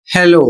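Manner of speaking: हेलो said as "heh-loh", ending on a long O; हेलो as a classroom example word; careful English pronunciation. Shown as a demonstration. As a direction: model हेलो with the O stretched at the end. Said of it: "इसका उच्चारण होगा".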